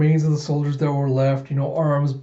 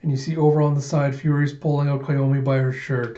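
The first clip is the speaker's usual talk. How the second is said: ordinary delivery, nothing marked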